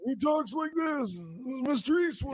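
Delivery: a rough mock impression of Eastwood; hammed-up impersonation of Eastwood; Clint Eastwood impression. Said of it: "Clint Eastwood".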